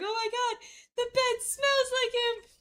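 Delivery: mock drooling. Smitten woman alone in his bedroom, mock squealing. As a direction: swoon in a hammed-up high voice. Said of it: "drooling everywhere".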